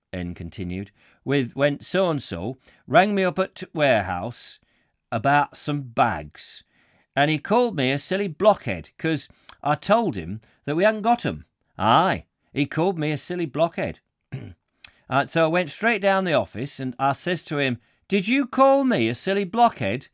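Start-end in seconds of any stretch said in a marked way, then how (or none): 1.2-17.8 higher, indignant Yorkshire storyteller; aggressive Yorkshire accent. Acted. Warehouseman recounts being called a blockhead, indignant Yorkshire accent. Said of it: "this aggressive Yorkshire voice". 18.1-20.1 loud, high-pitched Yorkshire challenge; aggressive Yorkshire voice. Acted. Warehouseman confronts his boss, aggressive Yorkshire voice. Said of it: "this aggressive Yorkshire voice".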